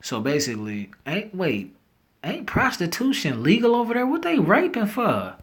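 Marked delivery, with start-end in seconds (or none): 2.2-5.4 incredulous. Real, not acted. Incredulous at legal prostitution.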